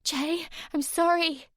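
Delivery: high, slow plea; pleading. A narrator's reading. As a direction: beg him to stop. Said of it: "Sammy pleaded".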